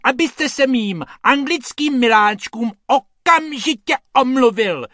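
lady scolds Hrubec over her dogs; shrill demand. Acted. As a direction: demand an apology, outraged and haughty. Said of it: "ječí ta dáma silným hlasem".

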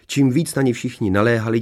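usual speaking voice

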